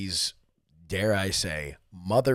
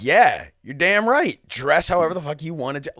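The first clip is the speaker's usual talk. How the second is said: loud, high-pitched fan cheering him on; worked-up, raised-voice endorsement; fired-up agreement; 7 semitones higher, 7 dB louder than usual. Retold or imitated. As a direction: egg him on, loud and emphatic. Fan's fired-up reply to the wrestler.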